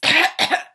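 loud hammed-up creature cough; staged cough. Lorax coughs at the Onceler, a hammed-up cough. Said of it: "He coughed and he whiffed".

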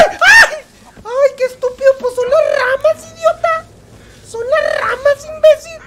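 high, loud mock shriek at the branches; hammed-up fright. Streamer startled by branches in the woods, hammed-up shriek. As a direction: squeal like a spooked kid, then snap at yourself.

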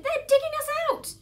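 high, loud cry of alarm; panicked high-pitched shout. Acted. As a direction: yell a warning to the family. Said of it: "he shouted".

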